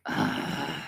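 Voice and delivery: low creaky "ugh"; exasperated groan. Retold or imitated. Mother hears her child call while reading, exasperated groan. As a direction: groan at being interrupted. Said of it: "And instantly I'm like".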